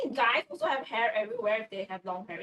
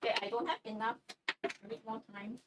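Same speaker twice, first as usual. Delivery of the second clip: normal talking voice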